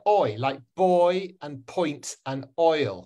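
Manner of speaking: put-on slow, stretched "oi" in "boy", "point", "oil"; diphthong demonstration. Shown as a demonstration. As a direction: model each "oi" word slowly for learners. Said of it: "Can you say boy".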